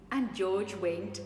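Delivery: ordinary delivery, nothing marked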